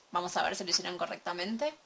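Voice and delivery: usual speaking voice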